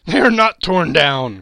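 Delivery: elated exclamation; joyful cry. A narrator's reading. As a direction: cry out in delighted relief. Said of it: "cried Scrooge".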